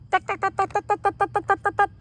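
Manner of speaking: loud street drill imitated for children; loud jackhammer tuck. A narrator's reading. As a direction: blast it like a jackhammer in your ears. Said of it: "It's really loud".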